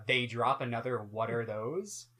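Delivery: ordinary delivery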